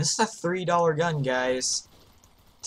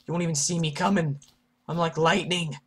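ordinary talk

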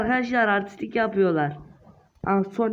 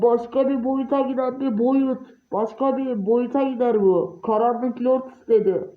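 ordinary delivery

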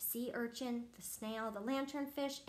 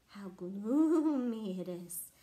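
usual speaking voice

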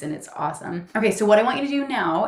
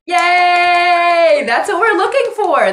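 much higher and louder, delighted cheer; 10 semitones higher, 12 dB louder, slower than usual. Real, not acted. Coach cheering her client after the calm song, excited. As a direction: celebrate her win out loud.

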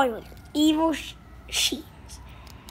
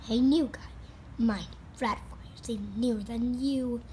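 normal talking voice

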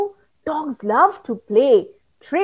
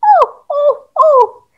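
alarmed cry; 11 semitones higher, 4 dB louder, slower than usual. Acted. Stick man cries out.

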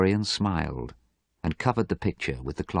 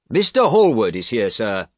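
lightly voiced servant, higher and louder; raised, formal servant's announcement; 4 semitones higher, 6 dB louder than usual. A narrator's reading. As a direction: announce a visitor to the master. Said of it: "the servant said".

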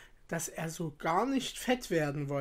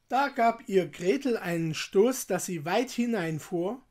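ordinary delivery

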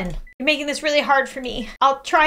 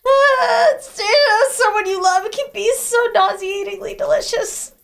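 real disgusted outcry; 8 semitones higher than usual. Real, not acted. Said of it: "reacting to the grim ending".

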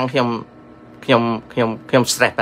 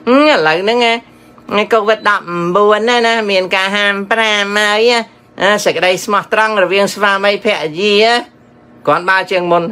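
lightly mocking impression of online youngsters; imitating young nieces; 2 semitones higher than usual. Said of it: "នៅ Facebook មានឃើញក្មួយៗគេថា".

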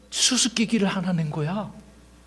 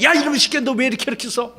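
higher mock furious scolding; angry confrontation; 6 semitones higher, faster than usual. Philistines confronting Samson, high mock angry. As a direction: confront him, hammed-up anger. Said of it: "따지기 시작하는 거야".

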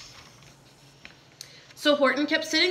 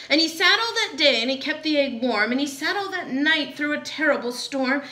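ordinary delivery

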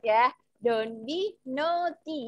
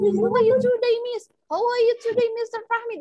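imitating an eager pupil, high and loud; brave student asking; 10 semitones higher, 6 dB louder than usual. Student boldly greeting the teachers in English, high, loud imitation. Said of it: "contohnya yang berani nanya ke Miss".

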